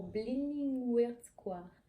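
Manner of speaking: a light English accent on Blenheim Square, drawn out slowly; slow down and try an English accent on the place name. Shown as a demonstration. Reading the book blurb, a hesitant English accent on the place name. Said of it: "mon accent anglais".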